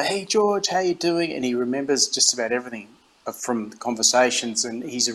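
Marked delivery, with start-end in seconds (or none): 0.0-1.3 much higher, bright mock greeting voice; imitating Tiger. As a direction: pitch up to play a friendly star saying hello.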